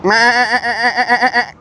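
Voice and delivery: high nasal-free bleating meh; goat bleat. Retold or imitated. Teasing the goats with a bleat.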